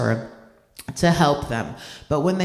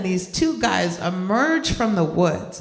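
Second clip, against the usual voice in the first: ordinary talk, nothing marked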